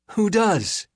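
high, bright and breezy; bright villain. A narrator's reading. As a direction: toss it off cheerfully, a menacing villain. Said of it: "says Skem brightly".

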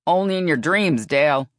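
loud, scornful comeback; loud dismissive holler; yelled retort. A narrator's reading. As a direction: yell it back over your shoulder. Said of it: "Phyllis yelled".

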